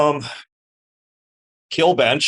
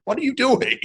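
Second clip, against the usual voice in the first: high, incredulous mock shout; imitated holler at normal volume; retold yell; 13 semitones higher than usual. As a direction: re-enact yelling at someone, pitch way up. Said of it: "very clearly hear me yell".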